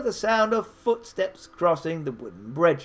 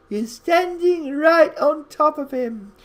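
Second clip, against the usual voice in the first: mocking beggar; 9 semitones higher than usual. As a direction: crow over the joke like a cackling old rogue. Beggar mocks the player.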